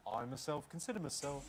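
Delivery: lilting stage cockney; Oliver! musical cockney. Acted. Panellist showing how it's phrased, musical cockney. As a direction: play it like an Oliver! urchin. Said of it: "sounds like a Lionel Bart".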